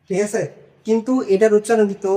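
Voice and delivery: usual speaking voice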